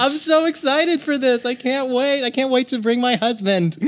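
high, giddy excitement; thrilled prize winner. Acted. Customer told she has won a free trip, thrilled. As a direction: gush in a high voice, softening at the end.

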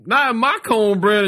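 high-pitched quoted punchline; Adam's catchphrase. Retold or imitated. Storyteller quotes Adam to the startled comedian, high catchphrase. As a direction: imitate a comic tossing out his catchphrase. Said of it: "And he goes".

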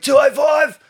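imitating the seller hollering over tyres; mock yell from the tyre pile. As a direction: call out from the back of the garage like the seller. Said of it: "couldn't actually speak properly".

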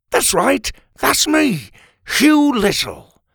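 high, loud, delighted confirmation; oblivious cheery dad. A narrator's reading. Father to the snarling cat, obliviously cheerful. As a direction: proudly announce yourself, missing the threat.

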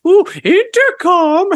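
high, slow, drawn-out mocking "Ooh, intercom"; mocking classmates. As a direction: tease it high and long like a schoolkid. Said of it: "And they're like".

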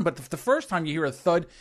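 normal talking voice

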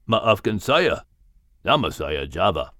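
low cat voice with Malay words; deep big cat; panther voice. Acted. Cat character introduces himself.

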